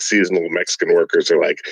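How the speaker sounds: normal talking voice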